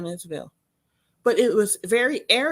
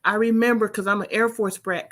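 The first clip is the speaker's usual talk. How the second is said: normal talking voice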